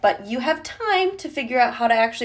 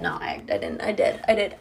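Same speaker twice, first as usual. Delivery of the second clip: ordinary talk, nothing marked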